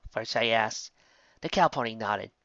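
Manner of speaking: plain reading voice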